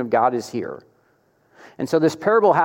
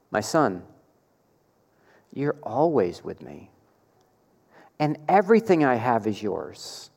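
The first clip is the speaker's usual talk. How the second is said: loving father; 4 semitones lower, 5 dB quieter than usual. Retold or imitated. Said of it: "His love".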